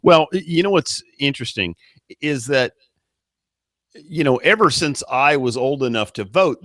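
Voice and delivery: ordinary delivery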